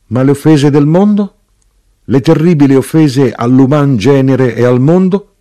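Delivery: loud, high, impassioned plea; dismayed questioning. A narrator's reading. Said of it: "con sgomento improvviso".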